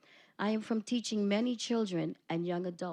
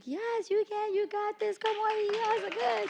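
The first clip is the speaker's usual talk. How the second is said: high, bright pep-talk cheer, much higher; cheering them on; 4 dB louder than usual. Retold or imitated. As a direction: pump up nervous kids like a coach. Teacher cheering on nervous students.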